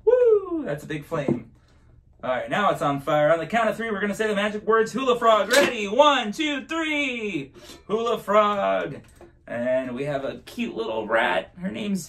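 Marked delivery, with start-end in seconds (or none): 0.0-0.6 excited whoop. Real, not acted. Flame bursts up big. 2.2-9.0 high, bouncy magic show voice; kids party magician. Acted. Performs magic trick for kids.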